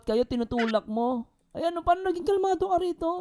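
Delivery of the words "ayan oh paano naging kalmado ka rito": high-pitched, agitated scolding; indignant. Real, not acted. Vlogger showing how the wife was holding him back, indignant. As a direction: point out the evidence in rising exasperation.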